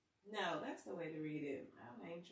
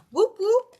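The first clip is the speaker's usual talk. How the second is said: excited whoop; 13 semitones higher, 28 dB louder than usual. Real, not acted. Cheering over buying Bitcoin stocks.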